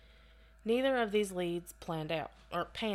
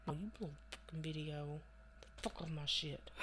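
under-the-breath cursing; muttered aside; 3 semitones lower, 10 dB quieter than usual. Real, not acted. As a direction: mutter it at the screen. Cursing at video mid-read.

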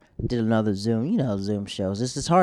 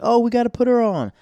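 much higher, louder mock-excited squeal; mocking eager organizers; 12 semitones higher, 6 dB louder than usual. Mocking the show runners who want the drunk comic on, high and eager. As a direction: ham up their eagerness to put her on.

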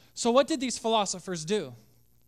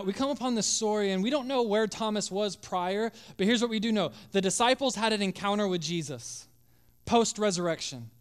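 ordinary talk

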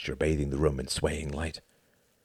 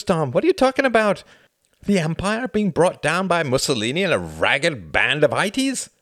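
loud and much higher, incredulous scoffing; a friend mocking a doomsday prophecy, loud and incredulous; heated rebuttal; 9 semitones higher, 6 dB louder than usual. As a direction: scoff at the idea, voice climbing.

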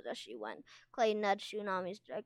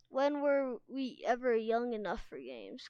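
ordinary delivery

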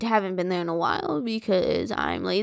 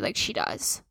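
whispered aside; 5 semitones lower, 11 dB quieter than usual. Real, not acted. Confiding gossip about neighbor.

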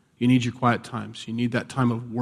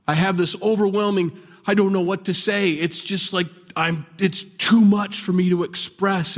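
higher-pitched, bursting with feeling; overwhelmed worshipper; 6 semitones higher than usual. Acted. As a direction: act out being too moved for words. Acting out being overwhelmed in prayer.

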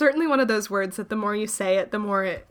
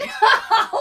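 high laugh; 12 semitones higher, 5 dB louder than usual. Real, not acted. Laughing at accent joke.

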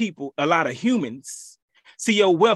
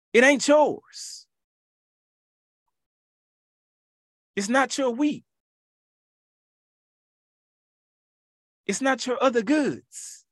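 stern rebuke; 8 semitones higher than usual. Acted. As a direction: talk down to him like God would. Preacher rebukes the rich fool.

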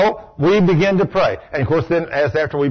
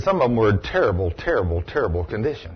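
grave; 8 semitones lower, 5 dB quieter than usual. Retold or imitated. Retold grim hospital memory.

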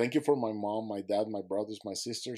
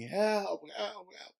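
retold yell for help; 10 semitones higher, much slower than usual. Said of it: "I'm gonna yell from the bottom".

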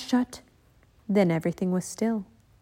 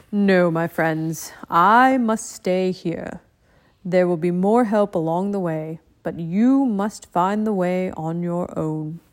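calm ninja master; 2 semitones lower, slower than usual. Acted. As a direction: speak like a wise old teacher.

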